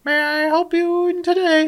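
very high, slow chirpy receptionist. Retold or imitated. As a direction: sing it out like a front desk greeter. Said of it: "Someone answers".

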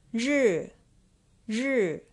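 ri said high and loud, twice; model pronunciation. Shown as a demonstration. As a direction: model the word ri for learners to repeat. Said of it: "Number four".

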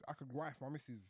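ordinary talk

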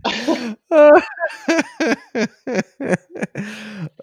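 high-pitched, drawn-out laughing fit; long laugh. Real, not acted. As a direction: laugh hard and long at a friend's story. Reaction to the funny story.

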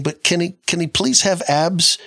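high pleading mock girl; teasing imitation of a pleading girl; mock girly plea. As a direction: beg for abs like a smitten girl. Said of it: "some of you are saying".

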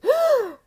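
sudden high gasp of pain; sharp gasp. Retold or imitated. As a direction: gasp as if stung. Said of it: "makes me catch my breath".

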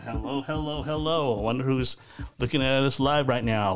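normal talking voice

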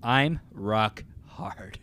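drawn out very slowly; slow proclamation. Acted. [name] proclaims to the goldfish army, slow and grand. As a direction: savour each word.

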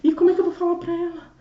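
retold panic. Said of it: "foi o meu susto".

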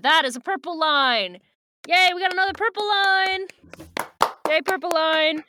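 loud, high celebratory announcement; gleeful cheer. Real, not acted. Host celebrating another purple line in the book, gleeful cheer. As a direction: whoop it out like a big win.

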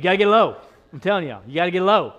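loud preaching shout. Real, not acted. Preacher shouting get low.

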